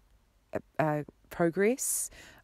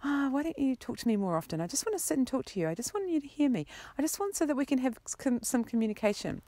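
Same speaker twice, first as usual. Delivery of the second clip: higher, fast pleading whine; whiny pleading; 8 semitones higher, faster than usual. Retold or imitated. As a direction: beg like a neglected lover, quickly. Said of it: "I'm like".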